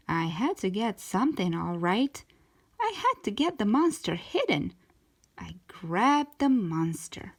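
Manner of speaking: usual speaking voice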